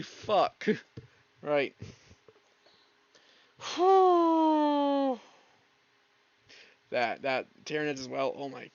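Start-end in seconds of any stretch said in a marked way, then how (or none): 3.6-5.1 long, high blown-out sigh; drawn-out exhale of relief; big sigh. Real, not acted. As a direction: let out a long whooshing breath. Recovering after the long list.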